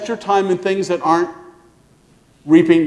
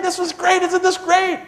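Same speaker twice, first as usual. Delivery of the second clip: put-on much higher, excited child's voice; giddy little kid; 11 semitones higher than usual. Little Liam on the pirate ship to his uncle, high giddy kid voice. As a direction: squeal it like a thrilled little boy. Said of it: "giggling and laughing".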